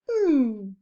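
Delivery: one short hummed beat; humming a beat. Shown as a demonstration. Teacher hums the one beat of the word for pupils, short and firm. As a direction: hum a single syllable. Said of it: "and it's to hum it".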